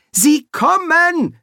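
high, loud, drawn-out cry; excited call. A narrator's reading. Boy announces the stars to the dean, calling out. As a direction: shout the news out in a high boy's voice. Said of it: "rief der Junge".

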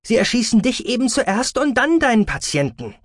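high-pitched daughter warning her father; Verbene, urgent reasoning. A narrator's reading. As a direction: plead for sense, pitched well up. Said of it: "versuchte es Verbene mit Vernunft". (mit hoher Stimme eindringlich warnend)

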